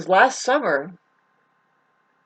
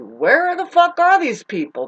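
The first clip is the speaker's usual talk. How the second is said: usual speaking voice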